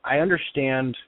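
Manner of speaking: ordinary talk, nothing marked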